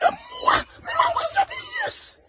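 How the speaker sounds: wailing and crying out, overcome with emotion; real emotional crying. Real, not acted. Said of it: "I'm too emotional".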